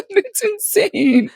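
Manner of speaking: high, slow laughing squeal. Real, not acted. Laughing at herself while talking about the whale.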